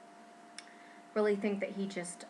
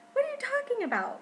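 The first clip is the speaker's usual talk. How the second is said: can't believe what you're hearing; incredulous; faster than usual. Retold or imitated. Incredulous reaction to nurse.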